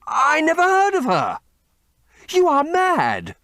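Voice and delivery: high, loud panicked protest; terrified Dorian. A narrator's reading. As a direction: cry out, cornered and terrified. Dorian pleading at gunpoint.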